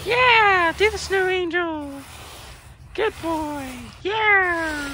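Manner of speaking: high, sing-song pet voice; talking to a dog. Pretend. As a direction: praise him like a good puppy. Coaxing friend like a dog.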